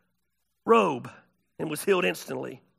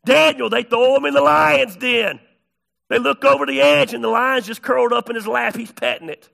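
loud, high-pitched sermon storytelling; full-force preaching; 5 semitones higher, 9 dB louder than usual. Real, not acted.

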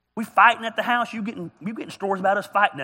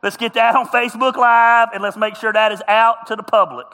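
laughing loud; 4 semitones higher, 9 dB louder than usual. Real, not acted. Jokingly announces to audience.

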